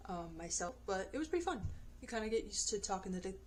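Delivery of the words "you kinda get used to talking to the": tripping over the words; stuttering. Real, not acted. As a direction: stammer and repeat the phrase. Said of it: "stuttering over and over again".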